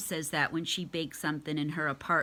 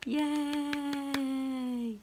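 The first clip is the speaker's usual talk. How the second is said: cheer; 6 semitones higher than usual. Real, not acted. Cheering at end of reading.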